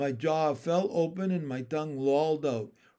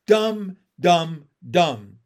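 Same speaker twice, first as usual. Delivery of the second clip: jab the turtle with every DUMB; loud, staccato "dumb, dumb, dumb"; punchy taunt; 9 dB louder, much slower than usual. A narrator's reading.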